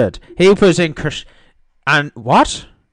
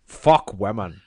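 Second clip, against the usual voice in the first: low, deliberate mock-Scottish voice; Sean Connery; 6 semitones lower than usual. Host insisting he is the actor, low Scottish impression. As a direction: drop low into a put-on Scottish accent. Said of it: "I am Sean Connery".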